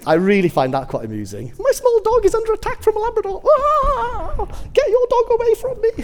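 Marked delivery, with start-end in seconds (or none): 1.6-6.0 very high, loud squealing; hammed-up hysterical shrieking at a stranger; mock panicked dog owner. Imitates panicking small-dog owner.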